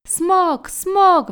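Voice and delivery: high, loud cries of alarm; crowd screaming. A narrator's reading. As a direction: yell it like a panicked mob. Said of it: "wrzeszczeli oni". (wrzask przerażonego tłumu)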